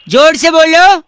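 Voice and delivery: loud call to speak up; shout. Pretend. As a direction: yell it over the line. Prank caller demands shouting.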